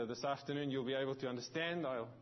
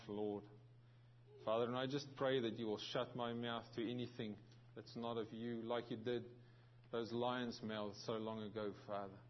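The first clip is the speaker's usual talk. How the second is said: hushed, reverent opening prayer; 5 semitones lower, 6 dB quieter than usual. Acted. Pastor opens the service in prayer, hushed and reverent. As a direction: pray softly and humbly to the Lord. Pastor prays before the sermon.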